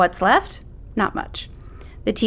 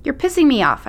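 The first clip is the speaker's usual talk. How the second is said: annoyed; 7 semitones higher, 4 dB louder than usual. Acted. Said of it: "I say".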